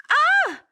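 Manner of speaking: high, loud yelp of pain; pained scream. Acted. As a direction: cry out as your hair is yanked. Hair grabbed in a fight.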